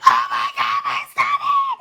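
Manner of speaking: imitated anguished scream, voice cracking very high; dramatic scream. Imitating her cousin screaming out in stomach pain, hammed-up wail. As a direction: ham up a sudden pained wail. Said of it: "She yelled out the most dramatic scream".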